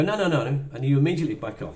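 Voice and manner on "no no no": loud, higher quick refusal; brushing off. Retold or imitated. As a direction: wave the assistant away. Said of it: "And you go".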